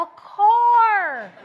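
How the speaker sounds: high, loud cry of joy; excited shout. Real, not acted. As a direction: shout it like you just saw your dream car. Reveals the showcase prize.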